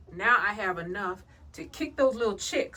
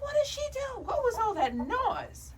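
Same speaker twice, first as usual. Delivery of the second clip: high bird voice. Pretend. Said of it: "You're like".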